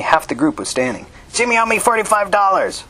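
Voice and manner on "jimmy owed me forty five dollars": high-pitched shout from the crowd; contained shout, pitched far up. A narrator's reading. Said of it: "someone Diz couldn't see shouted".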